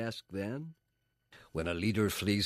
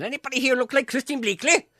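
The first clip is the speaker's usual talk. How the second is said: high, loud call to a crowd; 10 dB louder than usual. Retold or imitated. Producers calling out to a crowd, much higher and louder. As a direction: shout it out across a crowd in Wales. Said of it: "And they said".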